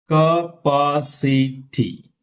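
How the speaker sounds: "Capacity" very slow, ca-pa-ci-ty; syllable by syllable. Shown as a demonstration. Teacher breaking word down for class, slow split word. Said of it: "Here we have stress".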